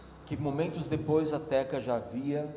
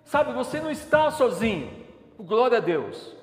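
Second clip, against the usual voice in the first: higher, louder impassioned sermon; fervent preaching; 6 semitones higher, 5 dB louder than usual. Acted. Pastor urges the congregation in the storm, fervent. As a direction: preach it with full fire.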